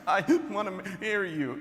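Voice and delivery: mock sobbing. Crying girlfriend proposing as she leaves, mock sobbing. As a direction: imitate her choking it out through sobs. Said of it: "it was more like in between stops".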